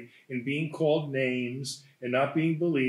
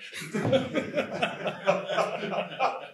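a long laugh climbing higher; long rising laugh; 8 semitones higher than usual. Real, not acted. Teacher laughing at a student's one-line exam answer, a long rising laugh. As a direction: laugh helplessly at the joke answer.

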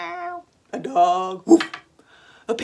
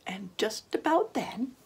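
suspense whisper; 2 semitones higher, 9 dB quieter than usual. A narrator's reading. Suspense before fox strikes.

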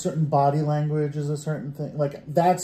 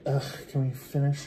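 softer, a touch of a bored sigh; 3 semitones lower, 7 dB quieter than usual. Retold or imitated. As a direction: sound fed up and done. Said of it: "I imagine you're very".

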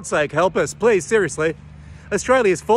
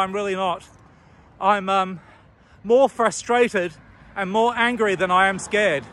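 ordinary talk, nothing marked

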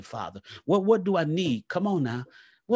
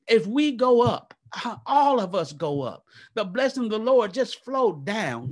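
louder, higher, rousing preacher's cry; preaching peak; 4 semitones higher, 4 dB louder than usual. Real, not acted. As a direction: lift the room at the climax. Sermon climbs to its peak.

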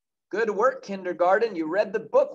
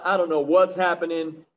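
ordinary delivery

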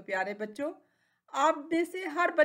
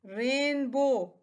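Rainbow slowly in two long beats; split into syllables; much slower than usual. Shown as a demonstration. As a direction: draw out रेन and बो in rainbow. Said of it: "इसके कितने syllables हैं". (Rainbow को दो हिस्सों में, धीरे)

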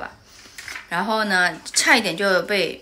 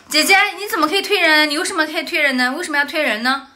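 higher, louder, fast reproach; retold angry scolding; 7 semitones higher, 6 dB louder, faster than usual. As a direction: scold the girl who pushed her son. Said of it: "所以我就生气了". (生气地质问推人的小女孩)

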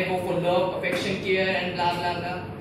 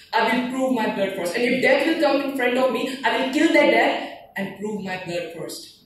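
emphatic, raised verse of resolve; defiant poem recitation; 5 semitones higher, 4 dB louder than usual. Acted. Soldier vowing to kill death itself, defiant declaiming.

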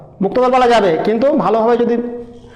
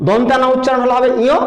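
ordinary talk

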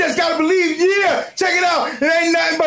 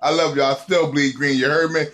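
sincere sign-off; 11 semitones lower than usual. Real, not acted. Fan signing off to his fellow fans. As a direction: say it low and heartfelt.

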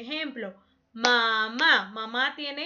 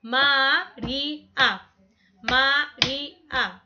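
María said slowly, A separated; syllables split apart; 3 dB louder, much slower than usual. Shown as a demonstration. Teacher showing María has three syllables, slowly. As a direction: draw out Ma-rí-a slowly. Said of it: "A va separado".

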